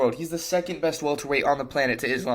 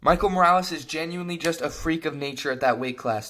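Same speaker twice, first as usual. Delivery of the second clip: usual speaking voice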